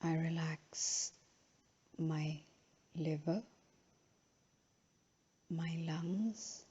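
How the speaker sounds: unhurried, measured phrases, each left to settle; guided relaxation. Real, not acted. Yoga teacher guiding a final relaxation in Shavasana, slow and calm.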